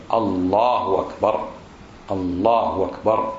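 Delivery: الله أكبر low and measured, with no letter waw; correct takbir. Shown as a demonstration. Teacher modelling the right takbir, careful and low. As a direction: say "Allahu akbar" deliberately, short u then أكبر. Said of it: "ليس في حرف الواو، وإنما هي".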